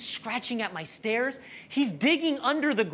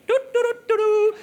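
high, loud "doo-doo" tootling; clueless baby bunny noise; 13 semitones higher, 7 dB louder than usual. Acted. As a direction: imitate an oblivious little bunny in high toots. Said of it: "The baby bunny's like".

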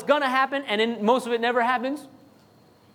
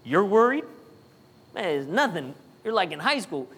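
mocking; 2 semitones lower than usual. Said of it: "like, mocking".

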